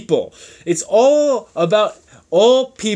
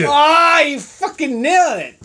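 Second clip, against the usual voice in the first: delighted exclamation; 6 semitones higher than usual. Real, not acted. Praising the co-host's accent impression, delighted exclamation.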